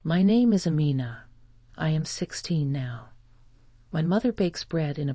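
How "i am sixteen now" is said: ordinary delivery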